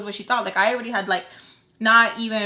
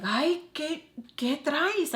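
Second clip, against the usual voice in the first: exasperated scolding; 6 semitones higher, much slower than usual. Retold or imitated. Voices Baltimore's exasperated Spanish scolding.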